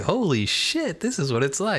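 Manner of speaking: high, thrilled exclamation; amazed. Retold or imitated. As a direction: gasp it out in wonder. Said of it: "and I was like".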